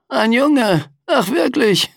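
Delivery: sarcastic echo, very high; mock the boy's words. A narrator's reading. Death mocks his reply. (spöttisch nachgeäfft)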